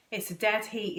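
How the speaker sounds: ordinary delivery, nothing marked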